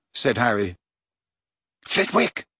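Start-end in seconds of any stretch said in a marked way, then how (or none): 1.9-2.5 warning tone. A narrator's reading. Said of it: "in a warning tone".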